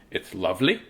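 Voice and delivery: normal talking voice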